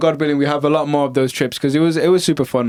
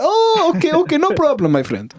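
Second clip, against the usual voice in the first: mock sudden friendliness; 14 semitones higher than usual. Angry Arabs backing down, lightly imitated friendly. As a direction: switch to eager politeness. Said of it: "They're like".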